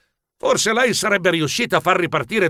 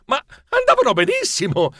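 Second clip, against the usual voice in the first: usual speaking voice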